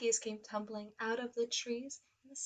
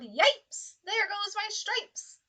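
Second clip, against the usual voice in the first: zebra yelling; 9 semitones higher, 6 dB louder, much slower than usual. Acted. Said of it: "The zebra yelled".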